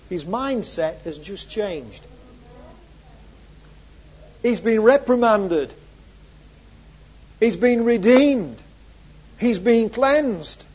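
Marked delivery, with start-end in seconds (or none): 4.4-10.6 loud, raised, hammered phrases; fervent pulpit declaration; emphatic preaching. Real, not acted. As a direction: proclaim each phrase with conviction. Preacher hammers three-part list.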